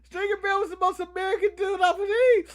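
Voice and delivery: high, loud hammed-up wail; mock crying. Fan learns the actor is English, mock crying. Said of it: "He cried a little".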